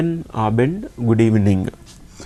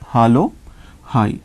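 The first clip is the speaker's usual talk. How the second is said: ordinary delivery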